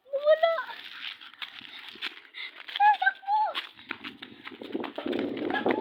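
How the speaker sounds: loud, high frantic yells; panicked shout. Acted. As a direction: scream it while running away. Kids flee from the ghost.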